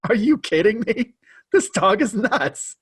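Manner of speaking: high, incredulous mock outburst; friend's disbelief. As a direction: play the friend who can't believe it. Friend reacts to crazy dog.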